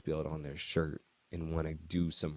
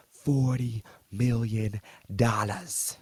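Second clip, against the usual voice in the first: slow, drawn-out, louder reveal; grand announcement; 3 semitones higher, 5 dB louder, much slower than usual. Retold or imitated. Agent revealing the big contract, slow proud reveal. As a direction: savor every word of the number.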